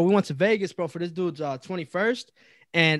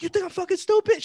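mock indignant guard; 11 semitones higher than usual. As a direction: imitate the guard doubting your story. Retells guard rejecting his protest.

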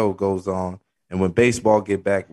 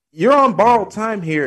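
high, loud and grave, like a prophecy; dramatic declaration; 10 semitones higher, 5 dB louder than usual. Acted. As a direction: announce doom with big drama. Dramatic repeat of the quote.

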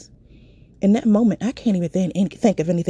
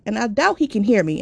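usual speaking voice

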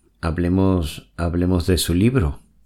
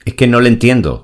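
raised voice; 6 semitones higher, 9 dB louder, faster than usual. Acted. Interviewer exasperated, raised voice.

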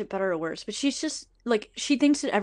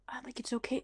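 whisper; 3 semitones higher, 12 dB quieter than usual. Retold or imitated. Eve answers, hurt and quiet.

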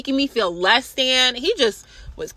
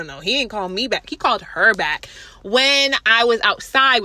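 ordinary delivery

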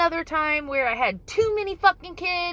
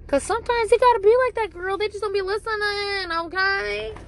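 higher, louder, quick sass; sassy girlfriend voice; 7 semitones higher, 3 dB louder than usual. Pretend. To her viewers about unruly kids, higher and louder sassy girl talk. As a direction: dish it like a sassy friend.

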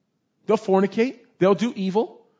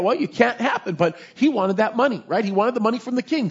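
ordinary talk, nothing marked

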